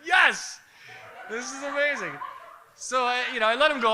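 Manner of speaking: high-pitched retold delight; acted-out fan excitement; gleeful. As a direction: burst out thrilled on the yes. Retells delight at being threatened.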